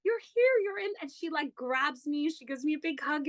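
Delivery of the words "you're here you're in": much higher, bright reassurance; host reassuring a newcomer, much higher; imitating Suchitra reassuring. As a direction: sweep a nervous guest in, high and lively. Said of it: "And she's like".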